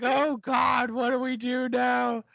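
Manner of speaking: playing terrified survivors; mock panic. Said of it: "they're like".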